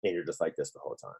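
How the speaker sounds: usual speaking voice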